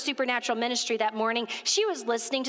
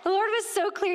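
emphatic; 9 semitones higher than usual. Real, not acted. Preaching to the church about God's clarity, fervent. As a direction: proclaim it with fervour.

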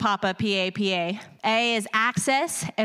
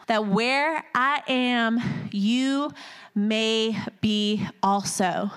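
slow, lingering; much slower than usual. Acted. Lingers on the promise.

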